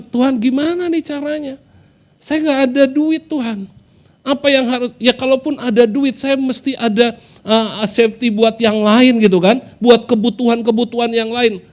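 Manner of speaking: high, pleading cry to God; lightly voiced desperate prayer to God. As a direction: beg God for a way out, voice lifted.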